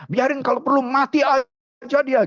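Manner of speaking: high, heated dismissal; acted father in a temper; emotional outburst. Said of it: "Ini karena papanya emosi".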